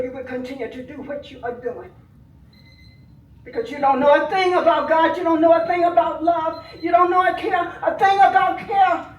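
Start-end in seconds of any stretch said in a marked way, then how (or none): 3.4-9.1 loud, rising furious accusation; angry shout. Real, not acted. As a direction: throw each line at them in rage. Grieving aunt accusing the killers.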